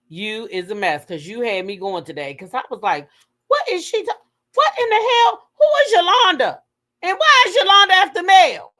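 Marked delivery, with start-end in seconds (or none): 3.5-8.7 high, loud retold questions; rising exasperation, acted out at full voice; baffled outburst. Host retelling confusion over a video, baffled outburst. Acts out own confused reaction.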